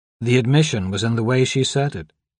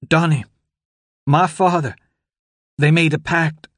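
feeble croak, higher; 6 semitones higher, 4 dB louder than usual. Acted. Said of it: "her voice feeble and distant".

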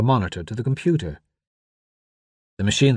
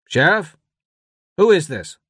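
raised voice of a puzzled deputy; questioning radio voice; 7 semitones higher, 4 dB louder than usual. A narrator's reading. Deputy on the radio questions an unknown caller, puzzled. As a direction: demand to know who is on the line. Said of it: "The radio crackled with a voice".

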